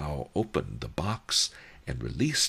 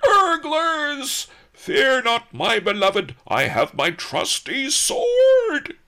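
very high, loud squawk, slowing on the sword; a pompous fool shrieking heroics; 10 dB louder, slower than usual. A narrator's reading. The governor squawks at the burglars to impress his beloved.

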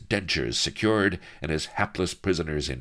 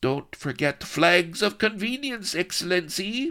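high-pitched lackey's reminder; obsequious henchman; 3 dB louder than usual. A narrator's reading. Enchilada reminds His Excellency, eager henchman voice. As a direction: chime in high, like a helpful sidekick.

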